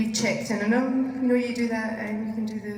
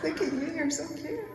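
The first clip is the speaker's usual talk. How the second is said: higher, softer coo; 6 semitones higher, 6 dB quieter than usual. Real, not acted. Cooing at a child in costume.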